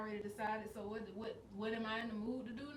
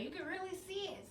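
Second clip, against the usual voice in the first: mother's eager remark; 10 semitones higher than usual. Retold or imitated. Retells mother's excited remark.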